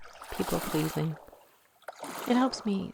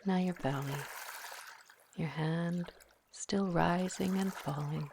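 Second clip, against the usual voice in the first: guided meditation; 5 dB quieter than usual. Acted. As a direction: ease the listener into calm, one breath at a time. Leads a guided visualization.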